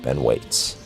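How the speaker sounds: ordinary delivery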